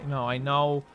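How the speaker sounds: ordinary talk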